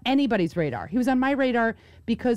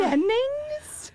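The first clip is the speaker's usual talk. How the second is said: very high, drawn-out outraged question; incredulous mom. Retold or imitated. Daughter reprising her mom's catchphrase for laughs, incredulous. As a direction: imitate a mom squealing the name in disbelief.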